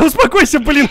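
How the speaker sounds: high laughing yell; laughing yelp. Real, not acted. Laughing, friend doing crazy stunt. (смеясь, выкрикнуть)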